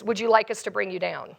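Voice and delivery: low, soft deadpan offer; kid on the PA. Retold or imitated. Ride kid offering the stuck rider a way out, quiet deadpan. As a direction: ask it coolly, dropped low and quiet. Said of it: "kid comes back on the P A".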